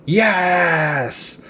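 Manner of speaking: high, exaggerated villain yes; a gleeful mock triumph; hammy yes. Freddy accepts demons' offer.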